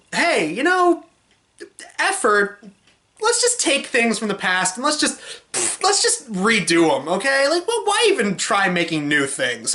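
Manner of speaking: hammed-up shrug-it-off pitch; mocking studio executive. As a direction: pitch up, breezily imitate a lazy producer. Voicing lazy studio heads, mockingly.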